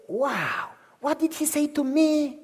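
wounded whiny voice; mocking the offended believer, self-pitying whine. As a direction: whine like a martyr.

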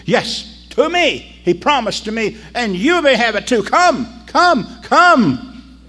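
high, loud, eager invitation; excited. Acted. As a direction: beg them to come with joy. Woman pleading with villagers.

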